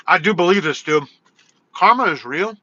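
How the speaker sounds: normal talking voice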